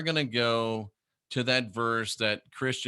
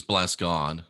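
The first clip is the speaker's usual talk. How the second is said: ordinary delivery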